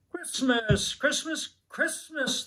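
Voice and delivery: put-on high, rising children's chant, fading; excited shouting children. A narrator's reading. Children waking their sister, high excited chant. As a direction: chant it like kids bouncing on a bed. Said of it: "they all shouted".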